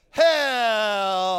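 Hello stretched long and whiny; drawn-out hello. Retold or imitated. Mocking how the locals stretch their Hello, long drawl. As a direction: drag out Hello like a Southern drawl. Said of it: "hanging on to a little bit too long".

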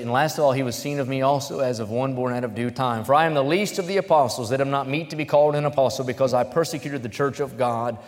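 fervent Bible reading from the pulpit; preaching scripture. Acted. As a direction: proclaim the verses to the congregation. Reads scripture at full force.

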